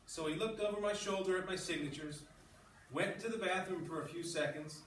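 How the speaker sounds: reading a story aloud to the class; steady read-aloud narration. Acted.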